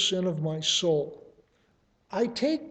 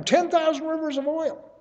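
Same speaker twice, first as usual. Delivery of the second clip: much higher, almost mocking disbelief; incredulous peak; 10 semitones higher than usual. Prophet's worshipper asking what will please the Lord, incredulous high. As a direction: push it up high as if the idea is absurd. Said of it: "the rhetorical question is".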